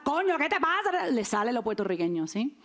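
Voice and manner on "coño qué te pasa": sudden higher Puerto Rican snap; mock angry cry in a Puerto Rican voice; angry Puerto Rican outburst. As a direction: blurt it out like you've lost your temper. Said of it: "pero cuando se enoja".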